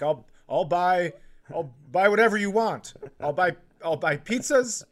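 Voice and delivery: high, loud, desperate pleading; mock meltdown bribing son with anything; frantic bribing. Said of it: "just having a mental breakdown".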